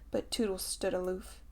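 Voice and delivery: usual speaking voice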